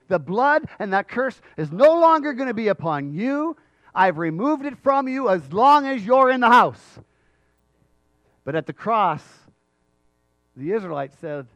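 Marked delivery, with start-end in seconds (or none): 0.1-7.0 loud, raised preaching; forceful proclamation. Acted. God to Rahab, forceful loud proclamation of release.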